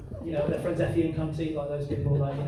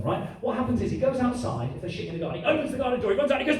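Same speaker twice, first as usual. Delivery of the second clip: ordinary talk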